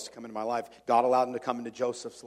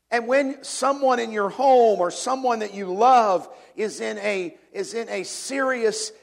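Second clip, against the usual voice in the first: loud, driving pulpit preaching; hammering each point home from the pulpit; full-force preaching; 9 semitones higher, 9 dB louder than usual. Acted. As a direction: preach it hard to the congregation.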